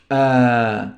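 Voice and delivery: ordinary talk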